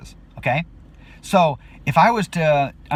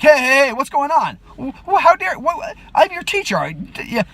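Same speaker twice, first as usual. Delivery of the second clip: high, loud, outraged yelling; angry outburst; 12 semitones higher, 5 dB louder than usual. Retold or imitated. Teacher yelling at the student who made a move, outraged and loud. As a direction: blow up at her in shock. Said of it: "I'm angry and I'm like".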